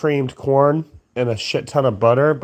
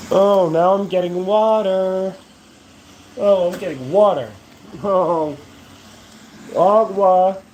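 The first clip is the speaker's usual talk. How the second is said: higher, cartoonish put-on voice; silly sleepy voice; 6 semitones higher than usual. Vlogger narrates his own yawns, higher goofy put-on voice. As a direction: play a goofy sleepy character for the camera.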